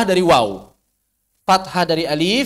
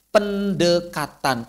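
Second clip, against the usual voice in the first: ordinary talk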